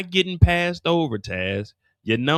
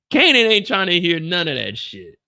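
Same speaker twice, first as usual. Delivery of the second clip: ordinary talk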